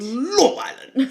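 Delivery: Love Island sung out high and slow; high drawn-out Love Island. Real, not acted. Repeats, pitched up and stretched.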